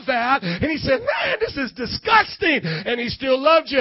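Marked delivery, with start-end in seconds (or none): 1.0-2.6 very high-pitched revulsion; mock disgust. Preacher voices God seeing what we did, exaggerated disgust. Acting God's disgust at sins.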